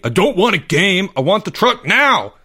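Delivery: high, loud tantrum scream. A narrator's reading. Greg to his mom, demanding the truck now, screaming. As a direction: throw a birthday tantrum at full voice. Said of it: "screamed Greg".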